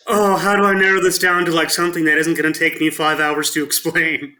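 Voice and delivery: high, loud, rattled-off comic exasperation; mock overwhelmed. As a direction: play at being swamped by the question. Jokingly voices an overwhelmed dad's reaction.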